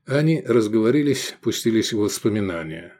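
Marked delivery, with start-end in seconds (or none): none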